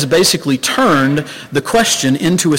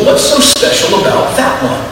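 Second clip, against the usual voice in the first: put-on curious young angel; 12 semitones higher, 4 dB louder than usual. Little angel asks, unimpressed.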